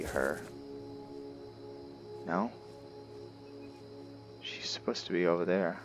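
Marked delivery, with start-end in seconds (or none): none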